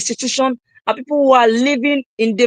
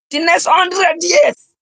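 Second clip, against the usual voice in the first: higher, slow, drawn-out shout; 7 semitones higher, much slower than usual. Real, not acted. Speaker urging his live audience, slow drawn-out shout. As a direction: hammer home the hundred years. Climax of motivational speech.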